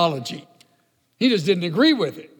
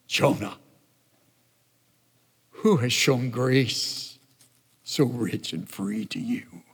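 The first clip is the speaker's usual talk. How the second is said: tender question; 6 semitones lower, 5 dB quieter than usual. Acted. Preacher asks Jonah tenderly.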